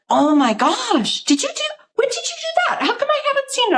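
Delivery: high, fast, gushing surprise; sister-in-law spotting the paintings, voiced high and thrilled; excited amazement. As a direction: imitate her rushing up in delighted disbelief.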